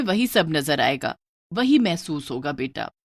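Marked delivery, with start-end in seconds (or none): none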